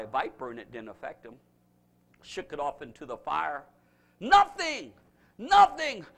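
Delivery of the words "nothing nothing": "Nothing" punched high and loud both times; preacher's emphatic "nothing, nothing". Acted. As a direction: hammer the word "nothing". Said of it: "emphatic repetition".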